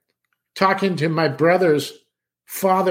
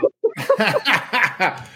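laughing; 7 semitones higher than usual. Real, not acted. Laughs at chat comment.

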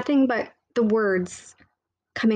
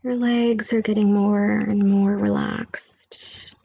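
whisper. Acted. Whispered meditation line.

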